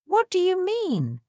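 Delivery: high, disbelieving question; bewildered. A narrator's reading. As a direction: ask in baffled shock. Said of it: "Andrea was bewildered".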